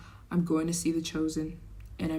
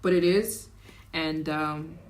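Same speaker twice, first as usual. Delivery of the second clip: ordinary delivery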